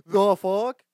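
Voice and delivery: loud mock-outraged question. Repeats his indignant reaction louder.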